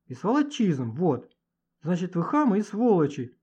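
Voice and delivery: high, emphatic scolding; hurt indignation. Acted. Hurt Manya accuses the boys.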